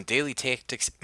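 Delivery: Daily Tactics in a Midwestern accent, flat drawn vowels. Real, not acted. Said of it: "put on a Midwestern accent like that".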